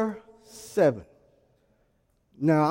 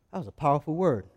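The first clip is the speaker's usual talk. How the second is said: quiet retold praise; 5 semitones lower, 6 dB quieter than usual. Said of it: "Somebody says to me".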